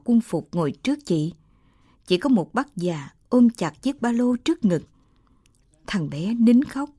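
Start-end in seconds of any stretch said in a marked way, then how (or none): none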